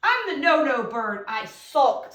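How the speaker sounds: put-on slow, expressive read-aloud for kids; reading a poem to children. Shown as a demonstration. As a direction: read it slowly and with feeling to the class. Reads the poem stanza.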